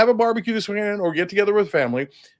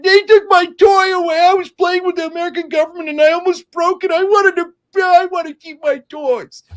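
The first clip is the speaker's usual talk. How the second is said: much higher and louder, a whiny toddler mocking pout; spoiled baby voice; 10 semitones higher, 5 dB louder than usual. Host mocking Trump losing the election, whiny high baby voice. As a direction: whine like a baby who lost his toy. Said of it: "He's a spoiled little baby".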